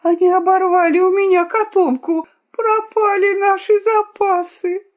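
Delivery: very high, loud wail of dismay; crying out. A narrator's reading. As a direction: lament the loss at a high pitch. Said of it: "крикнула хитраска". (высокий отчаянный крик)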